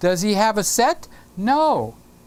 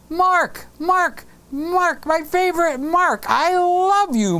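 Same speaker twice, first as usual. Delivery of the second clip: gushing call; 9 semitones higher, slower than usual. Acted. Gushing greeting to viewer Mark.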